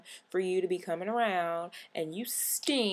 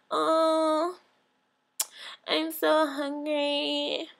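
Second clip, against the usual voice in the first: high, very slow mock whine; pitiful moocher; 8 semitones higher, much slower than usual. Broke friend at the table with no food, pitiful whine. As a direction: drag it out, begging for food. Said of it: "looking like".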